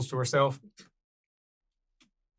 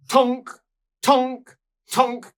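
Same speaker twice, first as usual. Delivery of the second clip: higher, louder tonk of hammers on anvils; hammer sounds; 9 semitones higher, 6 dB louder, much slower than usual. Acted. GM imitating dwarves hammering at their forges.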